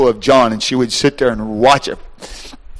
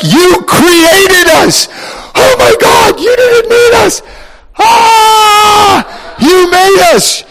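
high, loud, near-crying shout; overwhelmed creatures; 7 dB louder, slower than usual. Acted. Creatures cry out to God, high and near tears. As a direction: gasp it out, undone by wonder.

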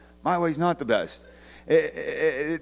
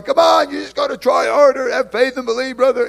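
higher, louder preachy push with a shout; pushy exhorter; 9 semitones higher, 7 dB louder than usual. Retold or imitated. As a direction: bark it like a pushy youth leader. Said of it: "I've been that guy going".

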